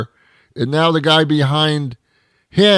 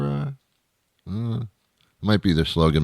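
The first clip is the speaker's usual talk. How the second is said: normal talking voice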